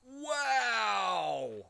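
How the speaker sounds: high, loud amazed wow. Real, not acted. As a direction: shout it in amazement. Exclaims loudly.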